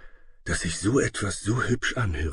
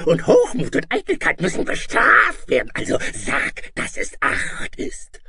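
high, harsh screeching monster tirade; enraged dragon scolding; 13 semitones higher, 5 dB louder than usual. Acted. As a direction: rant and bully like a furious dragon. Dragon scolds the defiant princess.

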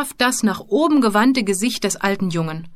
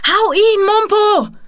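high, loud urgent shriek; 12 semitones higher, 7 dB louder, slower than usual. Acted. Bowman urging Mumpo to hit his attacker, shrieking. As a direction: shriek it across at your friend. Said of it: "kreischte Bowman".

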